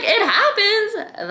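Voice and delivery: stretched, high "It happens?" in disbelief; incredulous echo. Retold or imitated. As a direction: repeat "It happens?" as if it's absurd. Echoing own words in disbelief.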